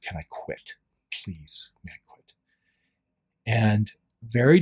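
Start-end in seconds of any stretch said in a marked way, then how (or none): 0.0-2.3 barely voiced, much softer plea; whispered prayer. Begging God beside the water heater, whispered plea.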